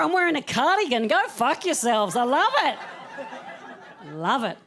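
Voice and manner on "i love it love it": ordinary delivery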